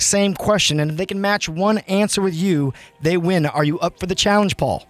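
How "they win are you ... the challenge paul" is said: ordinary talk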